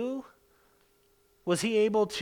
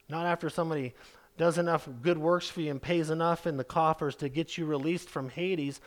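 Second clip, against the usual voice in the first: ordinary delivery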